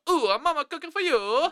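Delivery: high, hammed-up Italian accent. Shown as a demonstration. Host showing what a real Italian take would be. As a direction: sing it out like a cartoon Italian. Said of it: "if I did an Italian, I'd go".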